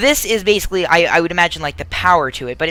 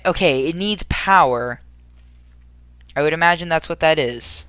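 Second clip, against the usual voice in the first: ordinary talk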